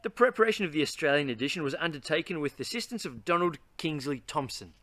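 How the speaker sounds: usual speaking voice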